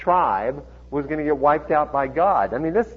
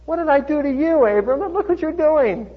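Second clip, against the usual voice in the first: very high, animated protest; acted high-pitched complaint; high agitated voice; 13 semitones higher, 3 dB louder than usual. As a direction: voice climbing as the indignant king objects. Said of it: "Voice was getting a little high".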